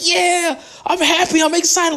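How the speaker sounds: imitating a giddy moviegoer, a hint of a laugh; mock excited fan. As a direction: parody the obnoxious guy's glee. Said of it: "He was getting excited".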